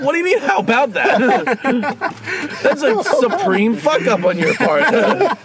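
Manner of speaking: high, incredulous outburst, breaking into a laugh; mock indignant outburst. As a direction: act out the outrage with a laugh. Retelling outrage at airline clerk.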